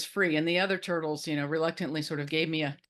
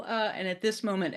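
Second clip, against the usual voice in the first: plain reading voice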